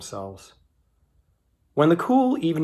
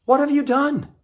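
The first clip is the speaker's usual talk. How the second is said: high, louder, stunned question; God confronting the woman, shocked; shocked demand; 14 semitones higher, 3 dB louder than usual. A narrator's reading. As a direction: voice leaping up in dismay.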